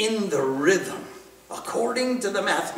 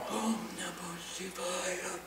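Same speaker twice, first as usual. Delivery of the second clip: much softer, slow whisper; whispered on the inhale; 15 dB quieter, slower than usual. Shown as a demonstration. Teacher whispers the mantra during the inhale. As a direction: barely breathe the mantra in.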